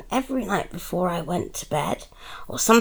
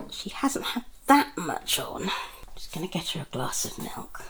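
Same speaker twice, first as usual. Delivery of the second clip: whispering; 5 dB quieter than usual. Acted. Aside, mother whispering to listener.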